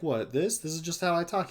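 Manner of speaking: a hint of a protesting country kid. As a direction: lightly imitate a kid defending how they talk. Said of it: "why do you talk like that".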